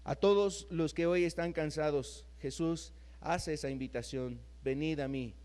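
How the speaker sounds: tender, hushed sermon voice; gentle preaching. Acted. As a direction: draw the tired listener in gently. Closing soft invitation.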